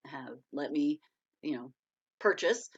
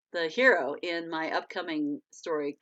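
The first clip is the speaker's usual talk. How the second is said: usual speaking voice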